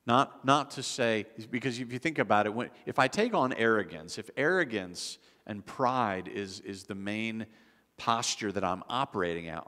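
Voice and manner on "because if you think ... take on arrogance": ordinary talk, nothing marked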